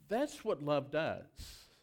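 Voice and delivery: ordinary talk, nothing marked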